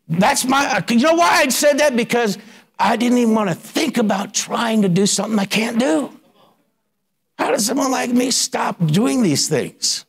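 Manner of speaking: high, urgent preacher's question; fervent questioning. Real, not acted. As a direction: demand the answer from the congregation. Preacher asking rhetorical questions.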